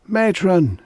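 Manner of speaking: high, loud desperate scream; frantic cry for the matron. A narrator's reading. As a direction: scream for help in panic. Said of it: "she screamed".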